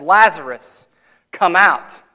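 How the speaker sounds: loud call into the tomb; commanding call. Retold or imitated. Jesus calls dead Lazarus out of the tomb, loud command. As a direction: summon him out with full voice.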